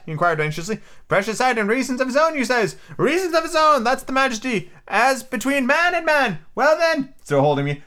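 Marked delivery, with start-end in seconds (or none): 1.1-7.1 put-on high, excitable old castaway; squeaky marooned sailor, eager and odd; lightly cracked high pirate voice; Ben Gunn voice. Ben Gunn's voice in the story.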